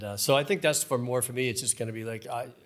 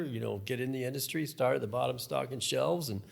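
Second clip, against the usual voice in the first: usual speaking voice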